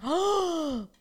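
sharp surprised gasp. Real, not acted. Recognising own habit.

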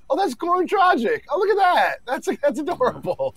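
high-pitched condescending coo; mock cooing. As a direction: coo as if at something cute. Mock viewer cooing at weaker player.

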